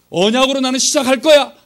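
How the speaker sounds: loud, high retold declaration; bold resolution. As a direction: declare your resolve with full voice. Retelling his bold resolution.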